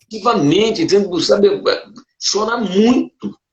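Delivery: real emotion, pitch lifted high; getting choked up while retelling it; moved, voice rising. Real, not acted. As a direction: voice straining with feeling. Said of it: "eu me emociono".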